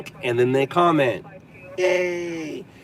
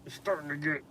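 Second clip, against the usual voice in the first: strained, much softer, desperate to pee; needing the toilet; 18 dB quieter than usual. Real, not acted. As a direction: hold it in while you talk. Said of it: "doing the pee dance".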